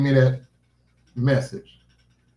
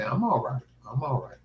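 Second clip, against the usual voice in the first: soft reassurance; 8 dB quieter than usual. Real, not acted. Reassuring dog.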